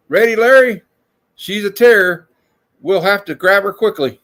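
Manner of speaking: high, loud cartoon urgency; hammed-up cartoon alarm; excited comic voice. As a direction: call out like a panicked hero. Dubbing comic panel, high loud.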